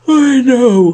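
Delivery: loud, high yawn stretched through the words. A narrator's reading. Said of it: "the other yawned".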